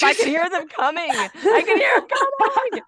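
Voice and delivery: high, loud, frantic pleading; hammed-up panic, laughing through it; mock panic. Begging husband over mower noise, mock panic. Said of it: "and I'm like".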